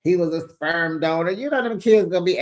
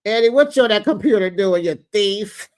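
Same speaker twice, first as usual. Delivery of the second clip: loud, quick, higher-pitched accusing outburst; mock accusation; 4 semitones higher, 6 dB louder than usual. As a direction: call him out like an outraged in-law. Acted mother accusing Eddie.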